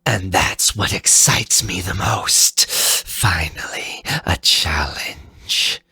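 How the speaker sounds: eager, slightly hushed boss voice; villain taunt. Acted. Fafnir relishing a real challenge, lightly voiced. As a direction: savour the challenge ahead.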